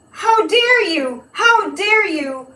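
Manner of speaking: high, slow, hammed-up outrage; mock indignation. Accused criminal blaming accusers, slow mock outrage. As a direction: play the wronged accused, drawn out. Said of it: "it's like".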